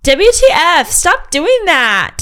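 put-on high, loud, slow child's shriek; shrill outraged kid yell. A narrator's reading. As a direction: shriek it like a bratty little kid. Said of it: "E K shrieked".

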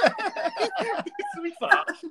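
high-pitched laughing disbelief. Real, not acted.

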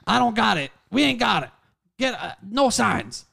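put-on loud, high, curt old neighbour; gruff retold reply. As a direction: bark back a flat refusal.

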